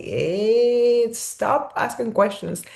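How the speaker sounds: lightly voiced annoyed father; impatient dad. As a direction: imitate a dad tired of questions. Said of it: "he would be like".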